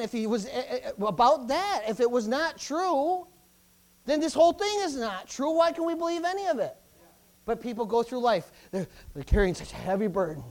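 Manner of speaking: ordinary delivery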